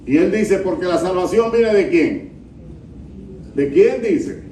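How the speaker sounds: loud, fervent pulpit delivery; preaching at full force. Acted. Pastor rebuking the pilgrims to Jerusalem: loud fervent preaching. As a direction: proclaim it loudly to the whole church.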